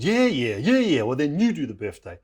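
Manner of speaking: loud, swooping yeah-yeahs; acted fed-up brush-off; dismissive retort. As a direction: brush them off impatiently, pitch jumping.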